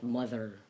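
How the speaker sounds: mother with a buzzed "th" in the middle; softly said mother, voiced th. Shown as a demonstration. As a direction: let the cords vibrate on the th of mother. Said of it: "T H voiced consonant".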